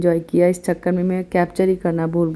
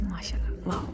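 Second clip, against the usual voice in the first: awed whisper; 13 dB quieter, faster than usual. Real, not acted. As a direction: whisper माशाल्लाह, wow in wonder. Admiring the night view quietly.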